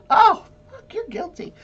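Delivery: sudden loud high outburst then drop; mock juror shock. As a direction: blurt it out like a stunned juror. Imagined juror reacting in shock.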